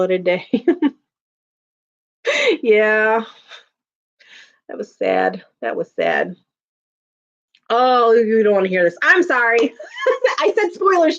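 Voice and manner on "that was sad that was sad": mock sad. Mourning a dead character.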